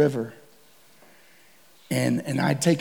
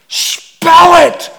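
much higher, louder sharp shout; shouted demand; 13 semitones higher, 13 dB louder than usual. Retold or imitated. Hunter demanding guide spell it, loud shout. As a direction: yell the demand in panic.

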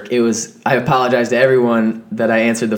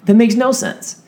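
much higher, giddy mock voice; voicing the urge to do something senseless; mock reckless inner voice; 10 semitones higher than usual. Friend impression of Nick deciding on a whim, much higher and giddy. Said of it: "he's just kinda like".

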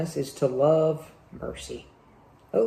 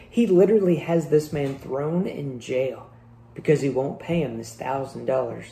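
usual speaking voice

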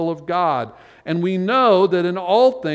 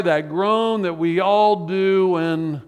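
ordinary delivery

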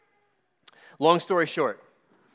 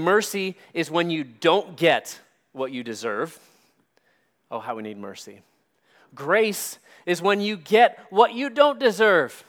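ordinary delivery

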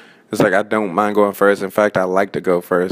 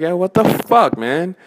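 exasperated; 9 semitones higher, 3 dB louder, slower than usual. Retold or imitated. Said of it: "It's like".

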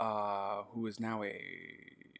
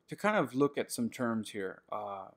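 ordinary talk

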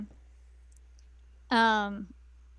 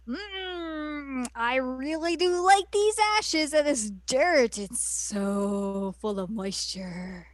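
creepy villain voice; 2 semitones higher, slower than usual. Retold or imitated. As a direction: purr like a creepy hag over the dirt. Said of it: "The beadiest of eyes is like".

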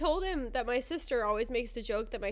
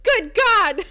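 loud, high comic outburst; shocked exclamation; 10 semitones higher, 13 dB louder than usual. Retold or imitated. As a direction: burst out in mock horror, nearly laughing. Replays the doctor's shocked timing.

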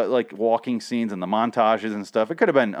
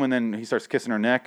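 usual speaking voice

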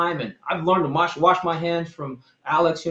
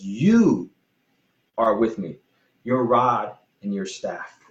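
"You" set apart, then a pause before "are with me", slow; stress on You; 4 semitones lower, slower than usual. Shown as a demonstration. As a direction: hold "You" alone, pause, then say the rest slowly. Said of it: "now he turns to God and he says".

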